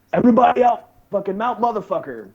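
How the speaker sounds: high, strained mock shout; imitated order. Instructors ordering trainees out after gong show, mock shouted command. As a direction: call it out like a sergeant. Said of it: "they're like".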